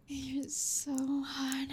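normal talking voice

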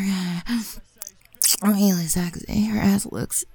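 usual speaking voice

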